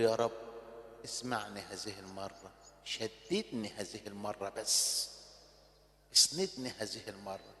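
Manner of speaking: very soft, low whispered prayer; hushed plea. Acted. Samson begging God for strength, very soft and low. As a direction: breathe it out to God.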